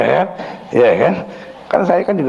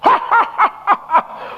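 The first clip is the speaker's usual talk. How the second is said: high staccato demonic cackle; possessed laugh. Retold or imitated. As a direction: cackle suddenly like a possessed man. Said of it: "dari nangis dia ketawa". (tawa setan melengking terputus-putus)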